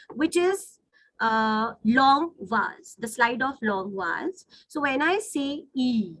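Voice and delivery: usual speaking voice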